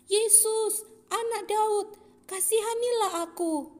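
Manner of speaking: high, loud crying out for mercy. Acted. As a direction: call out to Jesus across the crowd. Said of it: "mulailah ia berseru dengan suara nyaring". (berseru nyaring, tinggi dan keras)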